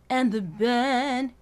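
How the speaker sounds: sung, leaning into band. Shown as a demonstration. Coach demonstrating lean for student. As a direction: press into band. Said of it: "lean into the word band".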